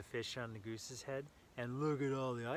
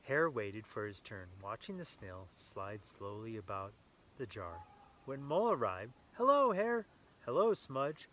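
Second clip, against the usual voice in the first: lively read-aloud storytelling voice; story narration; 5 dB louder than usual. A narrator's reading. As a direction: read it brightly to a small child. Reading picture book to child.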